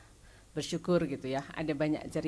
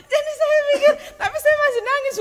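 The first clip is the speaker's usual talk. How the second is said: high laughing burst, louder; 13 dB louder than usual. Real, not acted. Sharing how she doubted her husband, laughing loudly. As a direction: laugh at herself mid-story.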